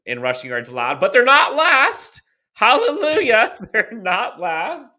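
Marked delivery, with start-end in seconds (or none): 1.0-2.2 excited. Real, not acted. First take, shouted in joy. 2.5-3.5 loud, high cheer of relief; excited. Real, not acted. Celebrating a bad defense ranking. 3.6-4.9 laughing. Real, not acted. Repeats the line, laughing.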